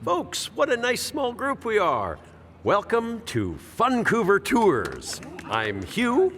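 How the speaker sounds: high, bright showman welcome, stretched out slowly; tour guide spiel. Acted. Guide welcoming a small tour group, hammed-up. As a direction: sell the tour like a cheesy guide.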